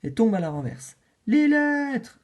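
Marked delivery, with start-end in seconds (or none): 1.3-2.2 high, loud cry of alarm. Acted. Facteur souris voit ses lettres tomber, cri affolé. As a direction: cry out as the letters scatter.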